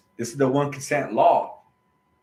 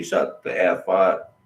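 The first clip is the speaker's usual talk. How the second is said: retold yelling; 6 semitones lower, 4 dB louder, slower than usual. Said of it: "This fool starts yelling at me".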